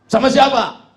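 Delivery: high, loud, abrupt question; tuyul voice. Acted. Tuyul questioning its client, high loud voice.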